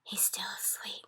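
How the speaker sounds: hushed, secretive whisper; voiceless, very soft aside. A narrator's reading. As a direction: whisper so the sleeper won't hear. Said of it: "the B F G whispered".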